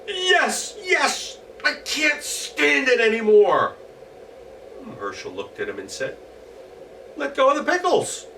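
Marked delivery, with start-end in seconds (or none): 0.1-3.8 high, loud begging goblin; desperate goblin. Acted. Goblin begs to be freed. 7.2-8.3 high, louder firm command; stern instruction. Acted. Herschel tells goblin.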